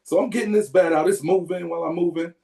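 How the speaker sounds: ordinary talk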